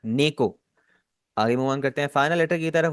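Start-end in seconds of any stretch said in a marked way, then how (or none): 0.0-0.6 नेको with ne not ni, short and even; Japanese neko. Shown as a demonstration. Teacher models the word for cat: clear नेको. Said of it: "बिल्ली को Japanese में कहते हैं नेको".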